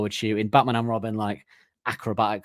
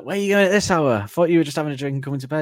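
nagging wife; 5 semitones higher, 3 dB louder, faster than usual. Retold or imitated. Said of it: "And she's like".

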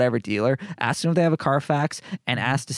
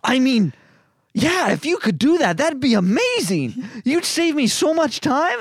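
higher, slow, amazed voice; mock amazed tech; 12 semitones higher, 5 dB louder, slower than usual. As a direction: stretch out the wonder, way up high. Tech amazed at radio code.

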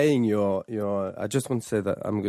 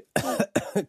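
demo cough; 12 semitones higher than usual. Shown as a demonstration. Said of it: "same word for tousser".

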